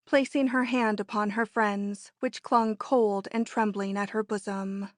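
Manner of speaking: usual speaking voice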